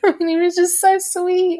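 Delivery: very high-pitched squeaky gush through a laugh; tearful gushing. Real, not acted. Fan moved to tears by a sweet TV scene, tearful gushing. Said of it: "I'm crying now".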